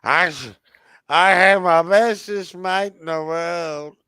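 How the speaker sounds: put-on high, loud, slow drunken slur; drunk Fluffy. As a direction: sing it out like a happy drunk. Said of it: "dealing with drunken Fluffy".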